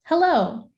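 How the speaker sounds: Hello, starting very high and falling sharply; exclamatory high-fall Hello,. Shown as a demonstration. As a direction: swoop down from the top on Hello,. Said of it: "we are hearing a different melody and tone".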